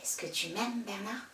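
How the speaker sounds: soft, tentative question; timid question. Acted. Girl asking her boyfriend if he loves her, timid and soft. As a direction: ask it small and quiet.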